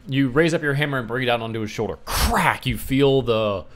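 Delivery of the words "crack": loud, high crack of impact; imitated bone-crunching crack. Game master voicing the hammer hit, explosive crack. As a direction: punch out the hammer blow.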